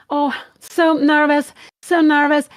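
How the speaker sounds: nervous. Real, not acted.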